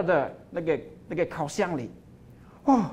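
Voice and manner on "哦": usual speaking voice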